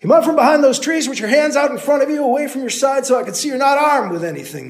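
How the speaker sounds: loud, high shout; shouted order. Acted. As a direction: bark the order uphill. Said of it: "the man called".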